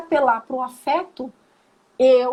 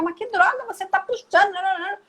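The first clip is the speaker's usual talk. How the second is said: mock annoyed scolding, pitched higher; 4 semitones higher than usual. Shown as a demonstration. Scolding a child who pulls his brother's hair, mock irritated. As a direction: nag the child the wrong way. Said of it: "você ficar".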